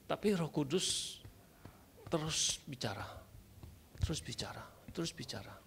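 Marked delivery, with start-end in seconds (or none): 2.1-5.5 soft, whispered repetition; hushed whisper. Retold or imitated. Preacher recalls the Holy Spirit speaking to him, hushed whisper. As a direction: whisper it insistently, over and over.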